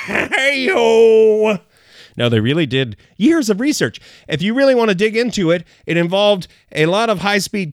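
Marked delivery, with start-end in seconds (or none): 0.1-1.6 laughing hey-o. Real, not acted. Celebrating own joke.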